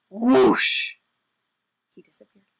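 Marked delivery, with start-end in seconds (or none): 0.1-0.9 sharp gasp, then loud whoosh; vanishing whoosh. Acted. Man vanishes at the word five.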